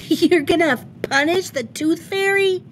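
put-on a little girl hopes the Tooth Fairy gets the punishment; hopeful child. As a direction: ask it as if rescue might come. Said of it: "Betty Bunny asked hopefully".

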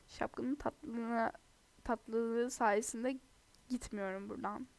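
ordinary talk, nothing marked

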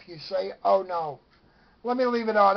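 normal talking voice

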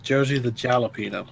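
"Josie the Jalapeno" with hard English J sounds, low and quick; English pronunciation. Shown as a demonstration. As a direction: insist on "Jalapeno" with J as in jam. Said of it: "That's English".

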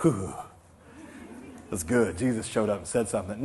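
low, soft reverent murmur; hushed mock awe. Preacher imitating believers soothed by Jesus showing up, hushed awe. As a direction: play the soothed, impressed believer. Acts awed, hushed listener reply.

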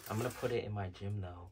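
usual speaking voice

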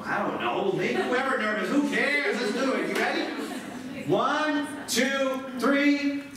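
high, projected hype-up call; rallying the audience. Real, not acted. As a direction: fire up the room for a chant. Counting audience into loud chant.

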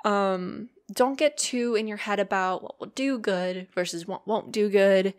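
ordinary talk, nothing marked

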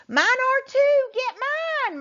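put-on high, loud girl's cry; a girl cries after her brother, high and loud; cried out. A narrator's reading. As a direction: call out to stop him. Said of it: "Marley cried".

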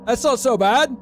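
mock Australian shrugging off a horror; lightly hammed-up Australian reaction; Australian accent. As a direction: put on a casual Aussie accent. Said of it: "If you were Australian, you'd probably be like".

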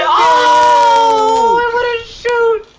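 high, loud cry of protest; exasperated shout. Real, not acted. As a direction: shout in dismay at the botched play. Reacting to a mistake in game.

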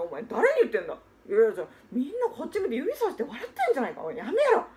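ordinary talk, nothing marked

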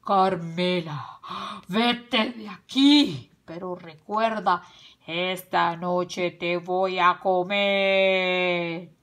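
loud, slow, drawn-out menace; angry wolf shout. A narrator's reading. Tío Lobo banishing Carmela in rage, slow menacing shout. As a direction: threaten in a big slow wolf voice. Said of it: "llenos de ira, tío Lobo gritó".

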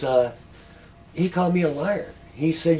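ordinary talk

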